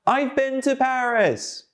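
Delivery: high, projected, drawn-out call; big high-pitched loud drill voice; loudly. Shown as a demonstration.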